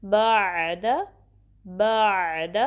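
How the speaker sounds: बाद with the ayn flowing from mid-throat; proper ayn. Shown as a demonstration. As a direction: draw the throat sound smoothly through बाद. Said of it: "आइन की practice करनी है properly".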